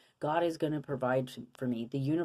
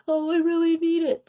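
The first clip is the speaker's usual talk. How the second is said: high, louder pleading whine; desperate begging; 14 semitones higher, 9 dB louder than usual. Shown as a demonstration. Mocking begging for energy, high desperate whine. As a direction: beg for it desperately. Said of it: "insufferable, desperate space".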